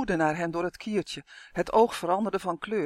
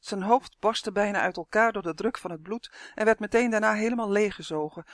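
usual speaking voice

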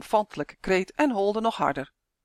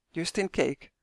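ordinary delivery

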